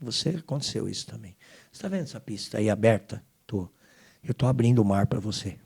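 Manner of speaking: Senhor falando baixo e mais grave, com ternura; voz divina baixa e serena. Retold or imitated. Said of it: "o senhor fala pra mim assim". (o Senhor consola o filho no aeroporto, em voz baixa)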